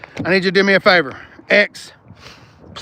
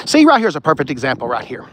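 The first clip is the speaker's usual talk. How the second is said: ordinary delivery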